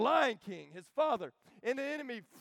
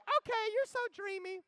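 put-on much higher, swooning girly voice; swooning girl; 12 semitones higher than usual. Nala swooning over Simba in a high girly voice. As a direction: sigh it like a lovestruck cub.

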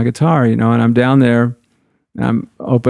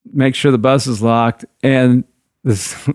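ordinary talk, nothing marked

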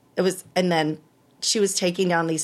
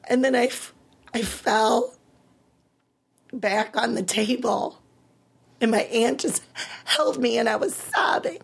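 higher voice, thinning into a whisper; close to tears; 5 semitones higher than usual. Real, not acted. Patient recalling the moment she got the diagnosis, close to tears. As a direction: voice giving way as the memory hits.

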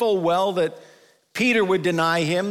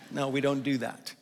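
usual speaking voice

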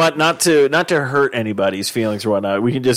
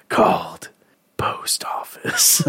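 whisper; 4 semitones lower, 3 dB quieter, much slower than usual. Pretend. Announcing a fake movie title.

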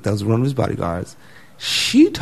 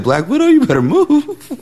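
sassy bodyguard; 5 dB louder than usual. Retold or imitated.

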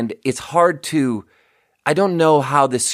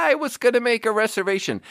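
mock shriek on the I, much higher and fast; screaming caller; 12 semitones higher, faster than usual. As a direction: do the caller who screams her first vowel. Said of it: "the scream was the I".

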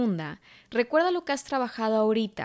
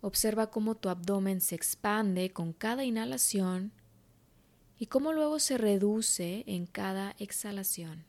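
guided meditation; 6 dB quieter than usual. Acted. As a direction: guide her gently into calm.

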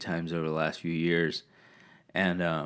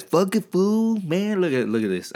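put-on East LA accent; 12 semitones higher, 7 dB louder than usual. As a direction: swagger it in thick East LA slang. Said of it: "East L A language class".

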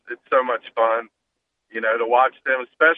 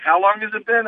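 put-on impatient kid; 10 semitones higher than usual.

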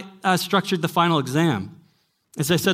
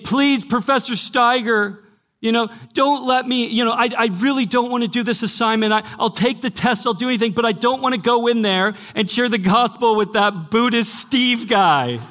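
imitating a pleading student; 7 semitones higher, 3 dB louder than usual. Retelling a frightened student begging her professor, mock-tearful pleading. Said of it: "weeping in fear and begging me".

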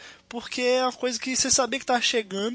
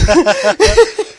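high shrieking laugh; shrieking jackpot winner; 12 semitones higher, 18 dB louder than usual. Real, not acted. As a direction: burst into shrill delighted laughter. Burst of laughter at joke.

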